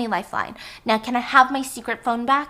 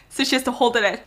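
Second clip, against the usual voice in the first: laughing; 8 dB louder than usual. Real, not acted. Host laughing while telling the hot sauce scene.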